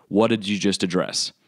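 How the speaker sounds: usual speaking voice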